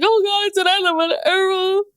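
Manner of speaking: high, hammed-up crying voice; mocking an ex-husband's crocodile tears, high and weepy; mock sobbing. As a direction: imitate a man blubbering over his loss. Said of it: "sobbing on your last day".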